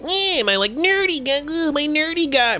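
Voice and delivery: high nerdy guy voice; squeaky nerd character. Shown as a demonstration. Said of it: "my nerdy guy voice".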